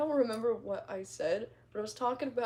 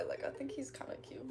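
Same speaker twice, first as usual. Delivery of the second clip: hushed confession, barely voiced; whispered admission she thinks he's cute; 2 semitones higher, 12 dB quieter than usual. Real, not acted. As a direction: lean in and whisper it like a secret. Confessing a crush to chat.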